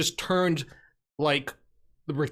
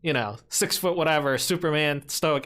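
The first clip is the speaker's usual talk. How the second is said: ordinary talk, nothing marked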